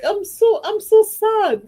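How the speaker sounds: high, slow, hammed-up lament; imitating a friend's theatrical grief; mock sadness. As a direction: overplay the sorrow like a soap opera. Imitating Jay's dramatic sadness.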